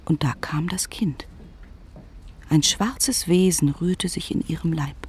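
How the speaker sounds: ordinary delivery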